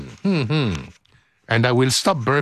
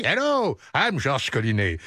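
ordinary talk, nothing marked

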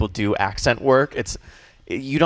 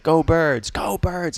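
higher-pitched mock sports cheer; fan cheer; 6 semitones higher, slower than usual. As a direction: call it out to a fellow fan. Acted fan cheer to passing stranger.

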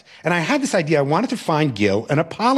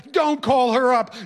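wailing plea; 8 semitones higher than usual. Retold or imitated. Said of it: "the wailing started again".

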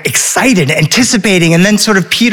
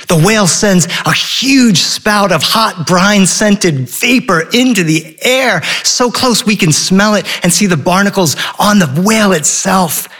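usual speaking voice

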